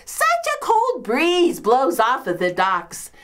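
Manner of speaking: high-pitched put-upon lament; self-pitying complaint. Acted. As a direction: complain like a long-suffering invalid. Complains of being a sick woman.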